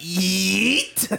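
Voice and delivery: mock royal battle cry; king yelling yeet. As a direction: toss it out as a cartoonish king. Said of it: "the king was like".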